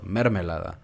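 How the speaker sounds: "Mermelada" stressed oddly on the first syllable MER; misplaced stress. Shown as a demonstration. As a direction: hit MER in "Mermelada", not LA. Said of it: "si le ponen más fuerza en otra sílaba". ("Mermelada" con la fuerza en MER)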